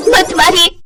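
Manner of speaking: high, begging bride; frightened pleading. Acted. Simran begs her mother-in-law not to burn her, frightened.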